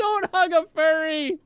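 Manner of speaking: high, slow, loud warning cry; alarmed shout. Real, not acted. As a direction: warn her off in alarm. Shocked warning to friend.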